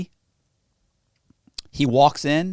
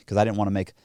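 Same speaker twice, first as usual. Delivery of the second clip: ordinary talk, nothing marked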